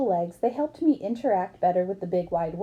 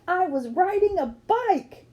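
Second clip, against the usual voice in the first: thrilled; 9 semitones higher, slower than usual. A narrator's reading. Narrator's thrill riding the bike.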